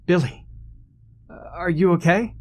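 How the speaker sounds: high, hesitant worried check; worried call. Acted. Friend calls down to fallen friend, worried.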